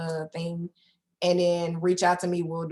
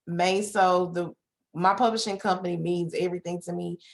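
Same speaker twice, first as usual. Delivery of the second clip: usual speaking voice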